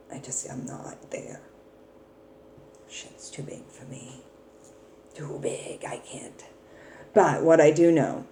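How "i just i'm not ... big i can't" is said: whispered, overwhelmed; whispering. Real, not acted. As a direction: whisper it, too much. Overwhelmed, dropping her voice.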